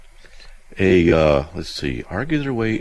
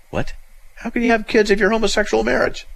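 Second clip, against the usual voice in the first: ordinary talk, nothing marked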